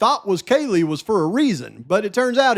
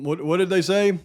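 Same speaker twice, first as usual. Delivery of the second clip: normal talking voice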